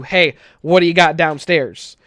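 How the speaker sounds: normal talking voice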